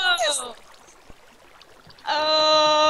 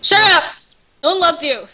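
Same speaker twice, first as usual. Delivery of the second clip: playful angry shout; 3 semitones higher, 5 dB louder than usual. Acted. Sibling teasing in game.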